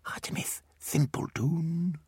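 put-on slow, soft child whisper. Miles mocks Artemis to his twin in a whisper. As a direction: whisper it from the corner of the mouth. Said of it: "Miles whispered to his twin".

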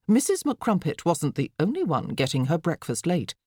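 ordinary delivery, nothing marked

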